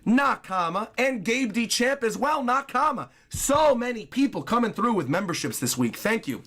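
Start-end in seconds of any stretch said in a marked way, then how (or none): none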